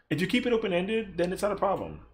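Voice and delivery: normal talking voice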